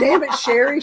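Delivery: exasperated quote with laughter nearby; retold exasperation. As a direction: playfully imitate a fed-up friend. Retold exasperated scolding of prankster friend.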